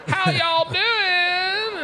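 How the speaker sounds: high, very slow Southern drawl; a drawn-out Southern accent. Retold or imitated. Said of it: "accents like South Carolina and Georgia".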